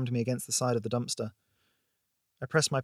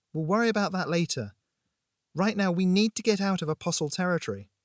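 urgent insistence; 7 semitones higher, 3 dB louder than usual. A narrator's reading. Urgent order to flee after argument.

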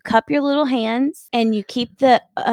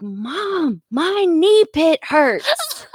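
put-on high, slow, drawn-out kid whine; whiny child; 10 semitones higher, much slower than usual. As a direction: whine it like a hurt little boy. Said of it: "he's like".

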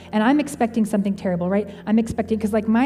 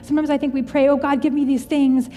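impassioned preaching; 4 semitones higher than usual. Real, not acted. Speaker urging listeners to trust Jesus, impassioned preaching. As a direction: build it up like a pastor at full force.

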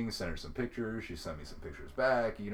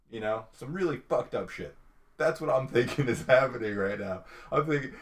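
usual speaking voice

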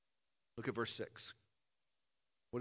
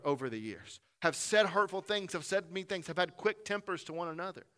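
ordinary talk, nothing marked

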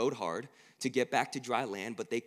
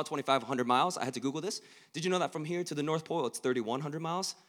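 usual speaking voice

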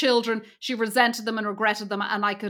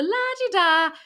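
higher, lightly imitated airy catchphrase; airy-fairy catchphrase; 8 semitones higher, slower than usual. Fan quoting a film heroine's catchphrase, airy-fairy. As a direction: float it up lightly, imitating her. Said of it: "she was so airy-fairy and light".

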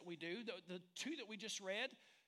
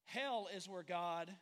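slow lead-in; 4 dB louder, slower than usual. Shown as a demonstration. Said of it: "Are you ready for this?".

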